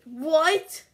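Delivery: loud high outraged what; shocked shout. Real, not acted. Shocked at the price of a hundred, loud outraged yell. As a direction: yell it in disbelief.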